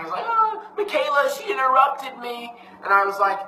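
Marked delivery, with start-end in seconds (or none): 0.2-2.6 put-on high, drawn-out whiny wail of a child; hammed-up childish sobby complaint; mock crying. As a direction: imitate your tearful son tattling on his sister. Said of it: "he's crying. He's like".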